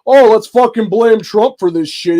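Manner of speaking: mock him with a drawn-out whiny sneer; mocking quote. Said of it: "has the audacity to be like".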